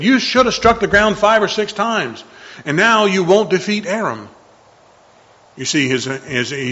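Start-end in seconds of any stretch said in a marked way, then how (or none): 0.0-4.3 louder, higher, stern rebuke; angry rebuke. A narrator's reading. Prophet Elisha angrily rebuking the king, raised voice. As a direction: scold the king hard. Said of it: "Elisha became very angry and rebuked him".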